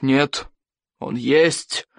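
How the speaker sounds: insisting, tightly held in; strained patience. A narrator's reading. Chieftain contradicting the boy, barely patient insistence. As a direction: insist slowly, holding back temper. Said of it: "упорствовал Норберт, с трудом сохраняя терпение".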